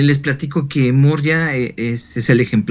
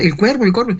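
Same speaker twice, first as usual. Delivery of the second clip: retold alarm; 7 semitones higher than usual. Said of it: "y me decían".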